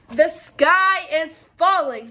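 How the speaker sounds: slow, loud dire warning; solemn alarm. Acted. The five birds tell Foxy Loxy, slow and loud. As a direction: spell out the danger.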